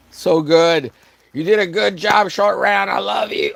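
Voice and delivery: put-on high, loud, overjoyed praise to a kid; gushing proud parent. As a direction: cheer the kid on like a doting parent. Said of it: "it'd be like".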